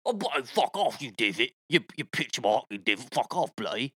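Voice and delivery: lightly Australian-accented mock cursing at the divot; a hint of an Aussie accent, swearing at the ball; mock Aussie rant. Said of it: "Now you sound a little Australian".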